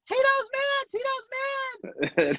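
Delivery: panicky Teddy. Retold or imitated. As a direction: squeal it like a panicking friend. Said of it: "Teddy's like".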